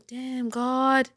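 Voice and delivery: normal talking voice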